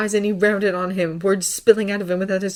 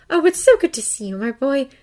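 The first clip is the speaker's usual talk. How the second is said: higher, louder, gushing welcome; breathed fond greeting; 8 semitones higher, 7 dB louder than usual. A narrator's reading. Aunt welcoming her nephew, breathed gushing greeting. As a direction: greet him with breathless delight. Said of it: "she breathed".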